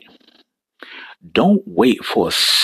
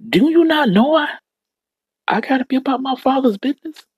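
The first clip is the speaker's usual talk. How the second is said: put-on boy Jesus; faster than usual. Jesus quoted again, raised voice.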